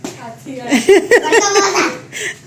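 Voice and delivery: high loud burst of laughter. Real, not acted. Mother laughing with viewers at her joke, loud laughter. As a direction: let the laugh roll out.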